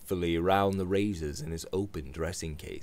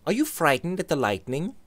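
higher, lightly teasing friend; Robert asks George, higher and teasing; Robert's voice; 9 semitones higher than usual. Acted.

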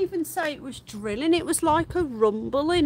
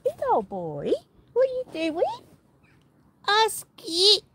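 much higher cooing pet voice; 8 semitones higher than usual. Real, not acted. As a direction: coo at the dog playfully. Talking to a pet.

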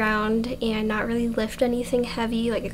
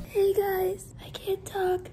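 whispering with no voice left; barely any voice, just breath; lost voice; 9 semitones higher, 9 dB quieter than usual. Real, not acted. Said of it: "I lost my voice".

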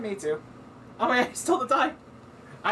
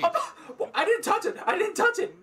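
shocked laughter; 8 semitones higher than usual. Real, not acted. Dice move on their own.

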